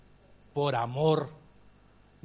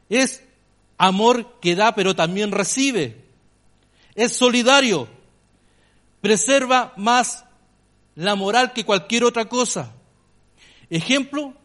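louder, higher, slow fervent preaching; 5 semitones higher, 8 dB louder, slower than usual. Acted. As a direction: preach each phrase with conviction. Preaching with rising force.

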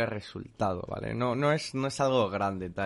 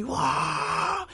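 long high "woo" of a cheering street; imitated far-off crowd howl, high and drawn out; crowd cheer imitation. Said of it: "Y empiezan ahí". (vitoreo agudo imitando a los vecinos)

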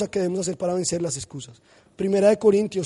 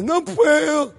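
whiny daughter; 7 semitones higher, 4 dB louder, much slower than usual. Retold or imitated. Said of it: "empieza llorando".

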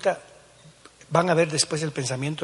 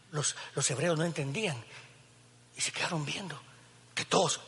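ordinary talk

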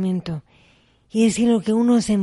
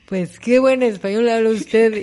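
slow, louder mock compliment from the man; imitating a stranger's surprised praise, drawn out; amused retelling of the man; 6 dB louder, slower than usual. Retelling the man who asked her country, mock slow praise.

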